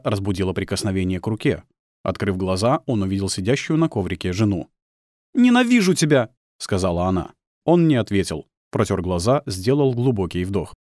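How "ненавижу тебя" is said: high, loud woman's voice full of hate; hateful outburst. Acted. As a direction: throw it at him, high and loud. Wife lashes out at her husband.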